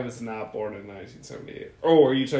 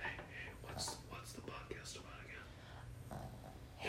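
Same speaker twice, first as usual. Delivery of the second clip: hushed whisper off mic; 20 dB quieter, slower than usual. Real, not acted. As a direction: whisper it so the mic won't catch it. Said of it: "Let me, let me cover the mic".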